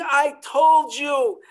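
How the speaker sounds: menacing smuggler shouting a warning; angry threat. Acted. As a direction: bark the threat at the family. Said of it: "this man is screaming".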